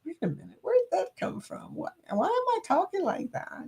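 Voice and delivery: high, hushed self-questioning; mock bewilderment. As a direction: act out catching yourself off guard, softly and high. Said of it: "then I hear it and I'm like".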